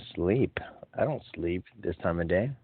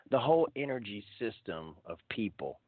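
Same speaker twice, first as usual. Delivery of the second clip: ordinary delivery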